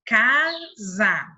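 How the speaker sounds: CASA split into ca and za, both stretched; drawn-out syllables in "casa". Shown as a demonstration. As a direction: say "casa" slowly in two separate beats. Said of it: "Olha só mais um exemplo".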